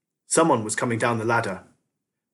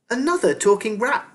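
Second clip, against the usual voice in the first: put-on girl's voice; 11 semitones higher, 3 dB louder than usual. A narrator's reading. Girl's voice in the story.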